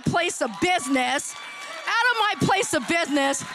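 building to a high, loud mock threat; hammed-up angry threat. Restaurant owner telling off the realtor in her head, mock fury. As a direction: build it up into a comic tirade.

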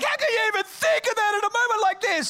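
high-pitched, loud indignation; hammed-up female outrage; mock outraged wife. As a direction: shriek it as an appalled wife. Outraged wife, acted by husband.

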